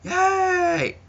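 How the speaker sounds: very high, drawn-out squeal; mock crowd cheer. Crowd answering the host, high mock cheer. Mimics crowd reacting to the names.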